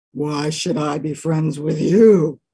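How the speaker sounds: a grudging bear, slowly; slow suspicious bear; gruff bear. Acted. Bear answers dog.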